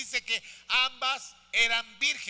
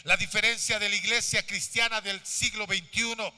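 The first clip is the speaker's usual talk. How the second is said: loud, driving sermon delivery; impassioned preacher building in volume; full-force preaching; 6 dB louder than usual. Real, not acted. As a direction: project to the whole church, hammering each phrase.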